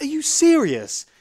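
high, drawn-out shout of disbelief; hammed-up outraged yell, stretched out; incredulous outburst. Customer reacting to a refused replacement, acted-out incredulous shout.

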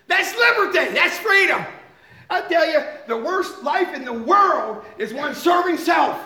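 high, loud pulpit proclamation; fervent preaching. Real, not acted. Preacher declares liberty over serving self, fervent. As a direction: proclaim it with full conviction.

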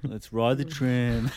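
slow, drawn-out mock slogan; catchphrase delivery. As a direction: sell it as a catchphrase.

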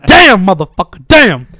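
loud frustrated outburst; venting at the printer; exasperated yell. Retold or imitated. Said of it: "I'll be like".

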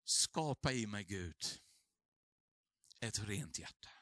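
low, soft, reverent plea; hushed prayer. Retold or imitated. Pastor voicing David's prayer for a clean heart, hushed and low. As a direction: pray it quietly, as if alone with God.

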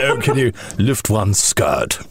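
slow, drawn-out lecherous voice; leering royal. Retold or imitated. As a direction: slowly ham up a dirty old prince. Mocking a leering prince.

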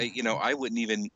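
usual speaking voice

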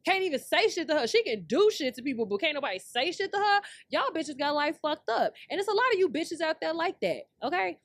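venting real outrage, voice climbing; furious rant. Real, not acted. Ranting at women who dodge consequences, loud and angry.

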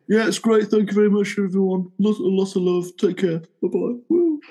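high, cheery imitation of Paul's farewell; mock Paul sign-off. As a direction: play the gushing star saying goodbye. Mimicking Paul's sign-off message.

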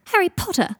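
high, loud exclamation; sudden high-pitched call; excited cry. A narrator's reading. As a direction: cry out the name on seeing him. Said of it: "cried Millicent".

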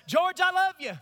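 preaching at full force. Real, not acted. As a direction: call it across the church with force. Shouted to congregants.

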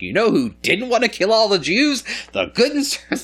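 mock ad read. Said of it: "It's like".

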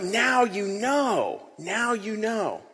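soft, very slow Now you know, now you know; hushed and drawn out, full of joy; joyful tender. Shown as a demonstration. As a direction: breathe it out gently like a happy secret. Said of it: "just in that joy of".

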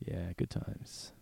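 slow, whispered dry irony; deadpan it under your breath; sarcastic. Real, not acted. Dry ironic aside.

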